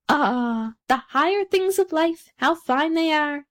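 high-pitched duck, slowing at the end; admiring duck. A narrator's reading. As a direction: gush wistfully as a high-voiced duck. Duck sighs over higher things.

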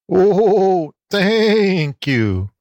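high, wavering goat bleat; bleating goat lady. A narrator's reading. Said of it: "bleated Aunt Letty".

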